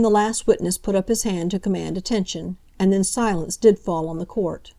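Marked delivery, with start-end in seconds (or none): none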